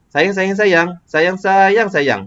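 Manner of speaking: "sayang, sayang, sayang" pitched high, then falling; expressive intonation. Shown as a demonstration. Teacher showing a student intonation, "sayang, sayang, sayang" high then falling. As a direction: lift "sayang, sayang" and drop on the last "sayang". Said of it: "kalau saya ada intonasi sikit".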